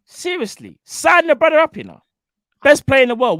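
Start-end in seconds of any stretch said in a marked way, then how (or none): 0.1-1.8 high, loud plea; exasperated shout. Real, not acted. 2.6-3.4 high, loud emphatic claim; loud insistence. Real, not acted.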